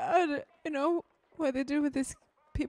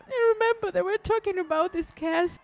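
crying in horror; 6 semitones higher than usual. Real, not acted. Viewer realizing they were gassed, crying in horror. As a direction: cry out through tears, pitch raised. Crying over gassing scene.

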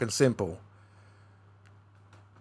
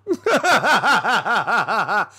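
bursts out laughing at himself; real laugh; 8 semitones higher, 4 dB louder than usual. Real, not acted. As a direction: laugh it off after the rant. Laughs at his own rage.

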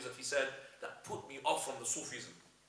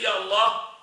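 crying out; 7 semitones higher, 15 dB louder, slower than usual. Acted. Follower cries out on the water.

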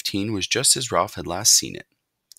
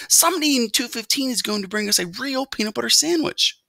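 high, excited mouse voice; eager young mouse; 4 dB louder than usual. A narrator's reading. Mouse interrupts mother to change the subject, eager. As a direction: blurt out big news to head off a scolding.

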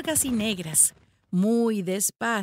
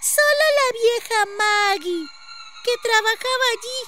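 put-on high, loud child voice; a small girl eagerly passing on news; high-pitched village child; little girl; 14 semitones higher, 5 dB louder than usual. A narrator's reading. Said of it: "contestó la niña".

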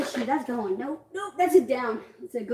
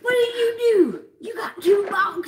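loud, drawn-out teasing yell; slow excited shout; 6 semitones higher, 3 dB louder, slower than usual. Real, not acted. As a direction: call her out loudly. Teasing outburst over prize.

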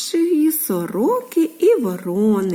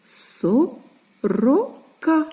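"Сорока" very slowly, split into three syllables; 3 semitones higher, much slower than usual. Shown as a demonstration. Teacher sounding out the written word for little children, "Сорока" syllable by syllable. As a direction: read out "Сорока" with a pause after each syllable. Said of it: "звуками, що ж тут написано".

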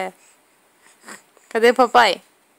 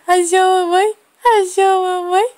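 squeaky praising a dog; 7 semitones higher, 3 dB louder than usual. Real, not acted. Mother cooing at her baby.

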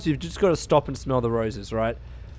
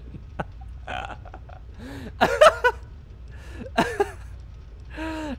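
long, wheezy high laugh; cracking up uncontrollably; helpless laughing fit; 14 semitones higher, 5 dB quieter than usual. Real, not acted. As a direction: laugh until out of air. Cracking up at the game.

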